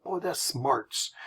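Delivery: put-on old folksy saying. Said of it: "I used to hear this when I was growing up".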